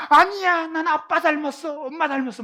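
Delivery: high, loud mock-child protest easing off. Child insisting to grandma they resemble their parents, protesting. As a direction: imitate a kid indignantly denying it. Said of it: "그러면 그 아이가".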